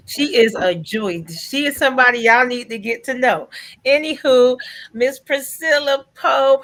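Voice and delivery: rising hype, drawing out Miss Priscilla Pope; hyped introduction. Real, not acted. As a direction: bring the next guest on like a show host. Host hypes and introduces next guest.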